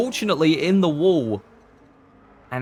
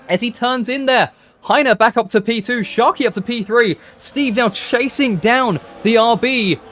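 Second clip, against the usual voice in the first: high, loud, urgent race call; excited commentary; 7 semitones higher, 7 dB louder than usual. Real, not acted. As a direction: call the crash with rising excitement.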